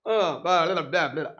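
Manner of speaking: low, soft mumbling that dodges the question; mock evasive mumble. Commentator mocking Kodali dodging the seat question, a low evasive mumble. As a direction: mumble like a man who won't commit. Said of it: "అంటున్నాడు".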